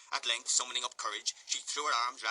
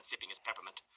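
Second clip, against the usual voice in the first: plain reading voice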